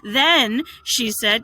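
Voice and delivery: usual speaking voice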